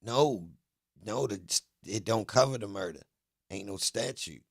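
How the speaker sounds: loud, insistent denial; emphatic objection. Real, not acted. Streamer argues murder has no statute, loudly emphatic.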